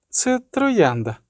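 put-on high, slow, calm child's voice; calm girl. A narrator's reading. Girl calmly answering the sorceress about a rose, calm. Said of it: "сказала спокійно дівчинка".

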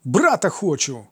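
slow, high exclamation; crying out. A narrator's reading. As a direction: cry it out like a demanding boy. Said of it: "скрикнув Гермій".

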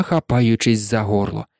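ordinary delivery, nothing marked

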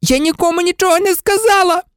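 very high, outraged screech; high-pitched furious protest. A narrator's reading. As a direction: screech it like a betrayed girl. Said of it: "заверещала Герміона".

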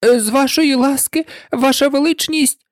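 a humble, hesitant address to royalty; very submissive. A narrator's reading. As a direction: speak up meekly to a monarch. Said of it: "дуже покірним голосом".

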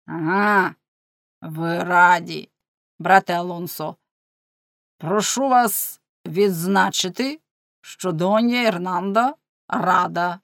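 slow, drawn-out sarcasm; mocking. A narrator's reading. Husband mocking his wife to the friar, sarcastic. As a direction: draw out each phrase to ridicule her. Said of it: "Глузуючи таким чином з дружини".

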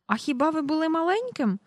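put-on high-pitched amazed boy; astonished question. A narrator's reading. Michael questions his father's childhood, astonished and high. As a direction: ask in wonder, voice climbing high. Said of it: "вражено спитав він".

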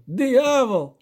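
high, loud mocking exclamation; amused disbelief. A narrator's reading. Poet scoffing at the elder's devil, loud and mocking. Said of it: "зареготав Любисток".

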